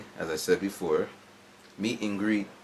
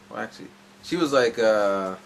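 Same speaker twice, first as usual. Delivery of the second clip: ordinary delivery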